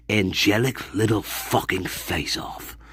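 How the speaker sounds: hissed, venomous whisper at the mate; angry whisper. Acted. Character seething at his sock-stealing companion, whispering. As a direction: whisper the threat through your teeth. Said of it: "Like that".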